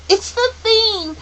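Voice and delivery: put-on high, loud child's cry; shrill-pitched, urgent exclamation. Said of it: "Charles Wallace cried".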